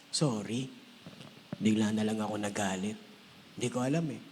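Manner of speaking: low, soft sincere sorry; soft apology. Acted. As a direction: say it quietly as if truly sorry. (mahinang taos-pusong paghingi ng tawad)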